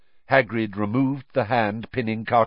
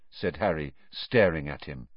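ordinary delivery

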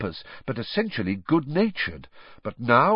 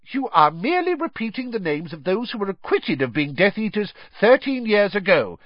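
higher, heated official scolding; angry; 8 semitones higher than usual. A narrator's reading. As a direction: snap back, offended and stern. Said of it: "said Fudge angrily".

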